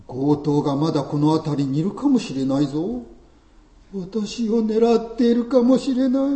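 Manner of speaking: high, pleading nervous servant; whiny, jumpy helper calling after his master; anxious Levite. Acted. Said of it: "レビ人の私を置いていかないでください".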